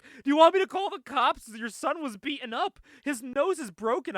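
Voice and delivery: high, loud mock alarm; alarmed woman. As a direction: imitate a woman urgently reporting an injury. Retelling an alarmed woman's outburst.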